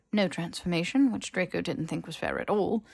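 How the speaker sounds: ordinary delivery, nothing marked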